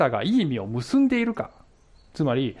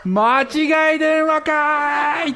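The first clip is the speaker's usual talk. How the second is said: slow, high, loud comic tsukkomi; comic retort; 11 semitones higher, 5 dB louder, much slower than usual. Pretend. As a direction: stretch out the punchline like a stand-up. Comic punchline realising wrong number. (間違い電話に気づいた大げさなツッコミ)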